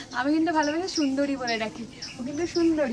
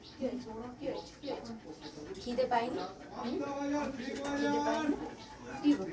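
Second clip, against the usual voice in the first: soft cooing; 3 semitones lower, 10 dB quieter than usual. Real, not acted. Cooing to a pet.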